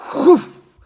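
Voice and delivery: sudden loud, high whoof; explosive imitated rush; whoosh noise. A narrator's reading. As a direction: burst it out like a suction pop.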